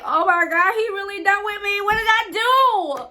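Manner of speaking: high, breaking wail of despair; retold crying. Girl pleading to her phone after he ghosts her, high tearful wail. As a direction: whimper it out like you're about to cry. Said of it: "Like, I'm crying".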